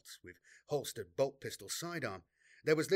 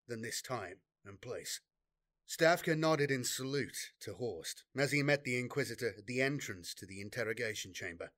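ordinary delivery

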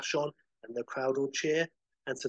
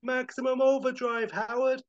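very high, sustained hollering; triumphant shout; 11 semitones higher, 5 dB louder than usual. Acted. Woody shouts to Howard from the machine, hollering. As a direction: holler it like a hyped-up announcer. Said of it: "And Woody shouts".

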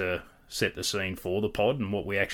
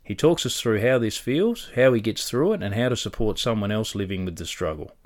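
louder, upbeat host intro; podcast intro read; 6 dB louder than usual. Acted. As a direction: sell the episode like a presenter. Reads the episode intro.

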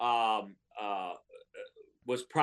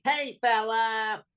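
pitched-up Bill Bergey impression; 7 semitones higher than usual. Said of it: "he had a voice like this".

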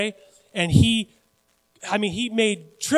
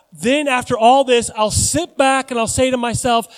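loud, raised-pitch mock boasting; hammed-up plan, pitched up and punched; self-satisfied rich man; 5 semitones higher, 7 dB louder than usual. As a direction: lightly act the rich fool savoring his plan. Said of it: "this is his heart".

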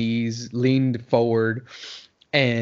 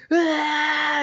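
long, high-pitched "aah"; mock burning-mouth scream. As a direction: act out a mouth on fire. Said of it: "on fire 'cause I've got hot water".

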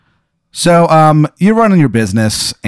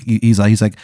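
usual speaking voice

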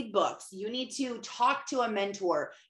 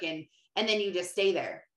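ordinary talk

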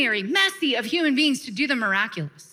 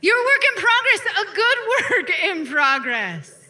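higher and louder, called out to the room; loud proclamation; 8 semitones higher, 4 dB louder than usual. Shown as a demonstration. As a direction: proclaim it to the whole church. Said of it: "Turn to your neighbor and say".

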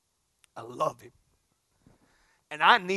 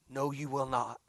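ordinary delivery

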